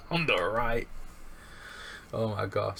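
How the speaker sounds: usual speaking voice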